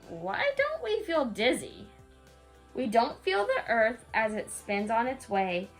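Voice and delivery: plain reading voice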